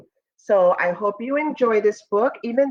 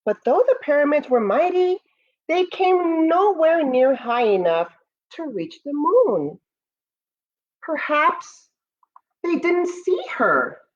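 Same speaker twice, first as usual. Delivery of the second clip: storybook narration; 2 semitones higher than usual. Acted. Teacher reading Moon's First Friends to students, storybook voice. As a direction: read it like a bedtime story for the class. Reads picture book aloud.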